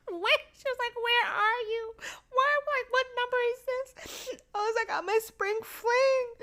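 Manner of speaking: high, squeaky laughter-strained voice; helpless with laughter. Real, not acted. Daughter retelling mom's phone questions, squeaking with laughter. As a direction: struggle to talk through the giggles, pitched high.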